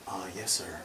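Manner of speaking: quiet, mild-mannered Marine reply; imitating a soft-spoken Marine; soft polite yes sir. Security guard quietly answers the officer, soft-spoken. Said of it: "He talks like this".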